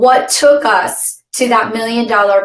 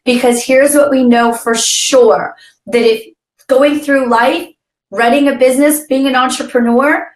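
ordinary talk, nothing marked

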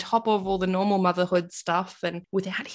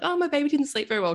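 mocking other mums; 9 semitones higher, faster than usual. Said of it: "a lot of women are like".

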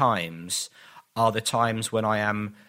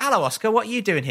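high, cheery greeting to a stranger; bright naive hello; 9 semitones higher, 3 dB louder, faster than usual. Retold or imitated. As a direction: chirp it out, clueless and friendly.

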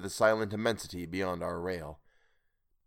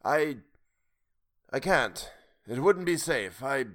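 halting, higher, long pauses; breaking off; 5 semitones higher, slower than usual. A narrator's reading. Old man refusing to come near, breaking off. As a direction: falter and break off unfinished. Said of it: "The voice broke off".

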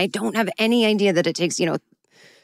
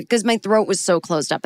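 normal talking voice

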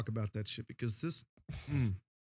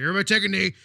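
loud, higher-pitched mock coach shout; coach calling out; 5 semitones higher, 9 dB louder, much faster than usual. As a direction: call the whole team in like a football coach. Acting coach calling the team.

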